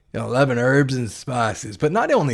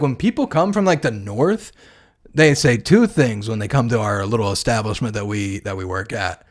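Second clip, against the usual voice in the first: ordinary delivery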